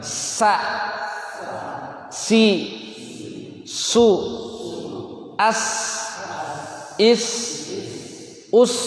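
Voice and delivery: each syllable alone, high, long hissed s; makhraj sa. Shown as a demonstration. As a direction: drill "Sa, Si, Su, As, Is, Us" with a thin s. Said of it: "Kita ambil dulu nih makhraj sa". (latihan sin tipis, satu-satu)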